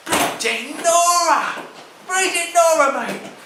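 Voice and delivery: cockney geezer gobsmacked; shocked exclamation. Acted. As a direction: stretch it out like you can't believe it.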